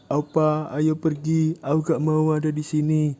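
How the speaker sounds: high, put-on wounded voice; acting hurt. Yura acting hurt to the fat guy, mock wounded. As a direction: pretend to be hurt to get away. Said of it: "Yura akting merasa tersakiti".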